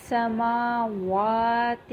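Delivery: سماوات with hissing s; slow سماوات with whistling sin; whistling seen. Shown as a demonstration. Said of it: "سٹی کی sound آئے گی".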